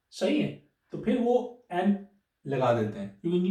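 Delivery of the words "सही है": usual speaking voice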